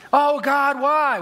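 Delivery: high, loud wail to heaven; anguished cry to God. Acted. Unlucky man pleads with God, acted anguished shout. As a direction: wail it upward in mock despair.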